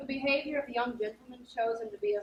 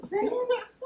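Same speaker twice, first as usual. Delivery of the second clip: baffled; 10 semitones higher than usual. Acted. Olivia baffled by Malvolio.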